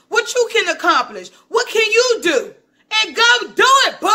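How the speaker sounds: hyped up. Real, not acted. Vlogger pushing viewers to go act, getting hype. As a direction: get hype and push them to act. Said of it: "I be so hype".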